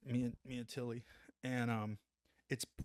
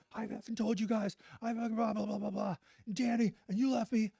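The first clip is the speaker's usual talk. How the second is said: high-pitched mock rant at his buddies; mock angry rant; 9 semitones higher than usual. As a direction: imitate him going off, higher. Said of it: "a stream of profanity".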